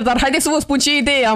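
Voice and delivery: ordinary delivery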